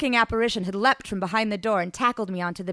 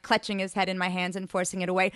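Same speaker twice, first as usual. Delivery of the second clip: usual speaking voice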